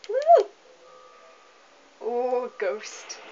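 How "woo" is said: loud, high whoop of delight; excited whoop. Real, not acted. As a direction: let out a real cheer. Cheering at game moment.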